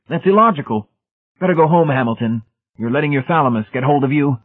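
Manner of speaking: high, loud, superior voice; zealous Brady, dismissive. A narrator's reading. Brady telling Hamilton to go home, dismissive. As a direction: lecture him, pitched right up.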